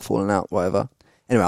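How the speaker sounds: normal talking voice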